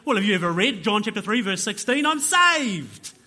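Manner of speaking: high, loud, rushed excitement building to a shout; overjoyed exclamation. Acted. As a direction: burst out with it, elated. Acted convert, overjoyed at salvation.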